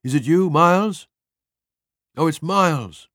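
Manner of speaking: loud and high, breathless with hope; desperate hope. A narrator's reading. Stella calls toward the hall, hoping it is Miles, loud and high. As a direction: cry out, clinging to the hope he's alive. Hears footsteps, hopes Miles alive.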